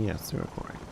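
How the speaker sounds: soft unvoiced whisper. Real, not acted. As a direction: breathe it as a whisper. Repeats the agreement in whisper.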